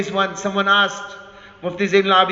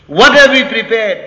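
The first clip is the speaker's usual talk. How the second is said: loud, raised challenge; urgent question; 3 semitones higher, 9 dB louder than usual. Real, not acted. Preacher challenging the congregation, loud and urgent. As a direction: demand an answer forcefully.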